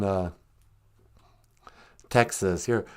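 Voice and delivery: usual speaking voice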